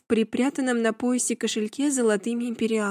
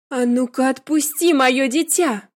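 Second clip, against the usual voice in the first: sharp loud command; 2 semitones higher, 6 dB louder than usual. A narrator's reading. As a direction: snap it at the nanny. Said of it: "резко сказала я". (резкий окрик)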